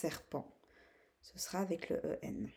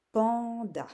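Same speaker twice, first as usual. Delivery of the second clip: "panda" higher and louder, long "pan"; syllable by syllable; 4 semitones higher, 8 dB louder than usual. Shown as a demonstration. Teacher naming the second picture, syllable by syllable. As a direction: hold "pan", then drop on "da". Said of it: "les deux syllabes de panda".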